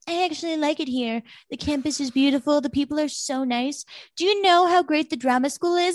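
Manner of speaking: a hint of a starry-eyed Serena gushing. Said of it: "and she's like".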